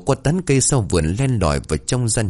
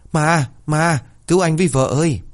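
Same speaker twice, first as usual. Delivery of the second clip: much higher, stammering in terror; terrified stammer; 8 semitones higher than usual. A narrator's reading. Frightened husband begs his wife to save him, stammering in terror. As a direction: stutter out the plea, scared stiff. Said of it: "mồm lắp bắp".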